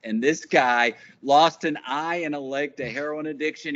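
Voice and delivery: ordinary delivery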